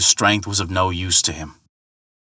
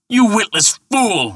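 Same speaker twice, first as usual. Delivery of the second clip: high, slow furious roar; 12 semitones higher, 3 dB louder than usual. Acted. Thor roaring at the mocking ferryman. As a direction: Thor roars down his insult. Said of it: "roared Thor".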